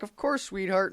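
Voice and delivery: high, sugary mock girlfriend; sweet girlfriend voice. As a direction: coo it like the lady friend. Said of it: "And she's like".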